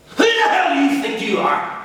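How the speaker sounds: father exploding at defiant son, furious shout; furious yell. Acted.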